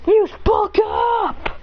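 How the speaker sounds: high, slow command call; trainer's command. Acted. Kukui calling a command to Incineroar, high and slow. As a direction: order the move with a long high call. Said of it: "As he says".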